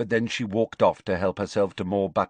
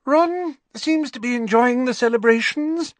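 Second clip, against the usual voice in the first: very high, strained social remark, louder; unnaturally high-pitched voice; 5 dB louder than usual. A narrator's reading. Hermione remarks on Ron to Harry, unnaturally high-pitched. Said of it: "in an unnaturally high-pitched voice".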